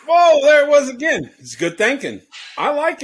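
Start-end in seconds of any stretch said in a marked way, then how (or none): 0.1-1.3 surprised. Real, not acted. Whip sound replays.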